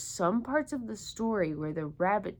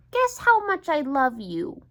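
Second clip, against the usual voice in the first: higher, louder eager little hare; 6 semitones higher, 8 dB louder than usual. Acted. Little hare asks big hare a question, eager. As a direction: ask it brightly like a small child. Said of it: "he said".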